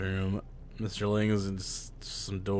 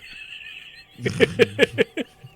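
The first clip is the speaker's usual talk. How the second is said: airy laugh; 13 semitones higher, 5 dB louder than usual. Real, not acted. Breathless laugh at teammates.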